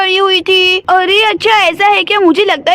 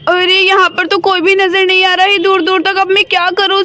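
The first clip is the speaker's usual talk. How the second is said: ordinary delivery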